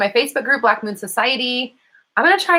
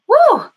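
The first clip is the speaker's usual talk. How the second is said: high loud cheering whoop; 8 dB louder than usual. Real, not acted. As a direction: let out a triumphant woo. Cheering after sassy remark.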